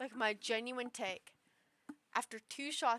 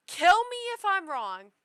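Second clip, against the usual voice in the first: kill me if I'm wrong, near shouted and higher; loud outburst; 6 semitones higher, 14 dB louder than usual. Real, not acted. Ranting about friends, a loud drunk outburst. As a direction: throw the line out loud like a dare. Drunk rant, daring the gods.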